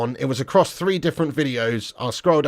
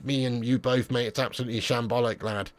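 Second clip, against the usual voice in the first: ordinary delivery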